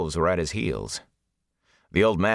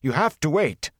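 high, urgent desperate plea; desperate high-voiced insistence; 9 semitones higher than usual. A narrator's reading. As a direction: beg them not to go, pitch raised. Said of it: "he said desperately".

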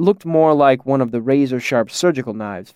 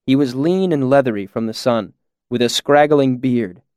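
ordinary delivery, nothing marked

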